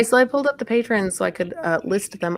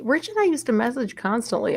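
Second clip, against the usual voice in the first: usual speaking voice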